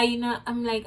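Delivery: ordinary talk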